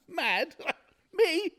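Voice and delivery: high, cackling, incredulous; mad laughter. Acted. Murderer scoffing at being called mad, cackling.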